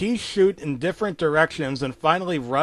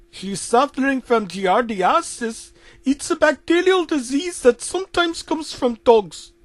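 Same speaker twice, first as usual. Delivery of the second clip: put-on higher-pitched Indian-accented doctor; 11 semitones higher, 5 dB louder than usual.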